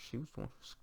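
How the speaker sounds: very soft, near-whispered mumble; under-breath mumble. Retold or imitated. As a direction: imitate someone grumbling it under his breath. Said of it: "he mumbles it under his breath".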